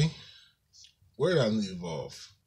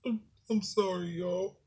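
small, high, soft tearful apology; tearing up; 8 semitones higher, 6 dB quieter than usual. Real, not acted. Vlogger apologizing to viewers after breaking down, tearful. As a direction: choke it out quietly, pitched up, fighting real tears. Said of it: "I start tearing up".

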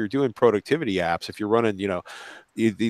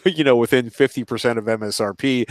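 laughing while talking; 7 semitones higher, 6 dB louder than usual. Real, not acted. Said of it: "laughs heard".

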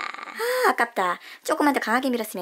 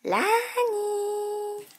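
long, high, sung-out name; name call; 8 semitones higher, much slower than usual. Acted. Host announcing the toy bus by name, sing-song call. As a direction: call the character like a cartoon intro.

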